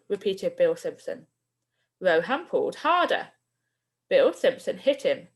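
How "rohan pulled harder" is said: ordinary delivery, nothing marked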